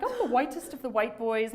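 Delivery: imitating a fake British accent; mock celebration in a put-on British voice; mock-celebratory British accent. Boy mocking his own whiteness in an interview, fake British accent. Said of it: "He's kind of mock celebrating his whiteness".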